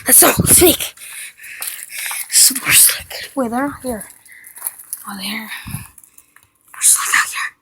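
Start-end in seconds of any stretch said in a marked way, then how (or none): 2.3-7.5 hushed sneaking whisper. Real, not acted. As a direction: whisper it so nobody hears. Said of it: "Gotta be really quiet".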